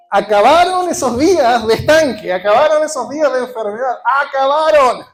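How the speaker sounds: loud, ringing declaration of victory; shouted proclamation. Acted. Preacher declaring to the church the sickness is over, shouted. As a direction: proclaim it like the chains just broke.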